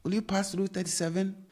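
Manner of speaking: Will you pass through thirty-seven asked high; question tune. Shown as a demonstration. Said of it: "yes or no question".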